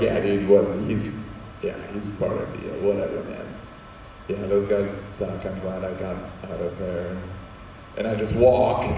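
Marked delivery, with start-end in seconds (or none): 0.0-7.2 low, soft, offhand brush-off; acted low-key snub, trailing away; dismissive. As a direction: play it cool and disown the friend. Snubs friend to impress cool kid.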